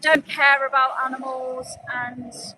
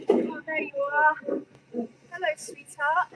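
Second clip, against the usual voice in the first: cooing softly to the animals, higher pitch; 5 semitones higher, 5 dB quieter than usual. Real, not acted. Activist greeting the penned cows, soft cooing baby talk. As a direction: sweet-talk the cows like babies. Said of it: "with a kind, um, tone".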